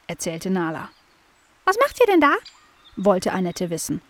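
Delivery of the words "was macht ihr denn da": very high chirpy blackbird; high bird voice. Acted. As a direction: squeak it like a tiny bird. Blackbird asks.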